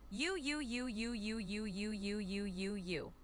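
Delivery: U chanted in an even, run-on string; repeated letter U. Shown as a demonstration. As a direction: repeat U evenly, letting the voice gradually sink. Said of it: "say that ten times".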